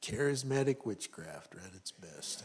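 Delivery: a dry laughing quip; laughing aside. Real, not acted. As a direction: laugh it off wryly. Wry laughing aside after story.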